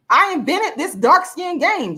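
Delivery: high, slow, smug mock boast; drawn-out sarcastic bragging impression; mocking claim. Mocking influencers who claim her idea, slow and high.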